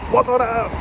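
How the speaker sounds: high, loud what on earth; retelling baffled reaction to the accents; incredulous. Retold or imitated. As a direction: exclaim in mock disbelief. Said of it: "I'm just like".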